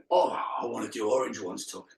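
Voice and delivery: a hint of a moaning classmate asking for easier bands; Diane impression. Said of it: "that was my impression of Diane".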